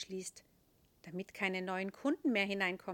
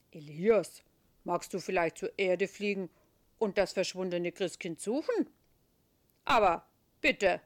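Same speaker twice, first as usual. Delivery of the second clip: louder, changed voice of a kindly chief; Petrus; 10 dB louder than usual. Acted. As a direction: give the mission with a fond smile. Petrus gives the angel a mission.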